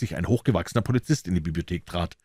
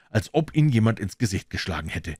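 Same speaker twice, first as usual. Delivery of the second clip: ordinary delivery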